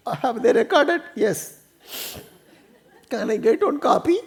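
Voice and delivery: high, laughing woman's voice; the wife keen to be rid of her trouble; high giggling plea; eager wife. Retold or imitated. Wife asks for recording.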